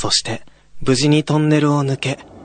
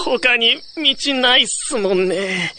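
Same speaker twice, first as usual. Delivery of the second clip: higher, slow laid-back junior; casual young guy; 11 semitones higher, slower than usual. Acted. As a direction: shrug it off as a student. Young man character. (軽い後輩口調)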